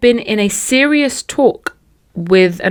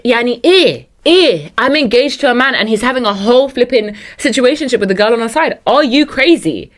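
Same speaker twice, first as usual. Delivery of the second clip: ranting; 5 semitones higher, 3 dB louder than usual. Acted. Ranting in character.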